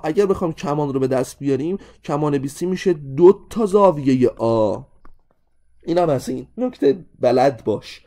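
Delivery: normal talking voice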